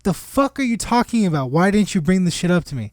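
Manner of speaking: high, exasperated retold outburst; incredulous anger. Boss to employee who hid the scam, incredulous.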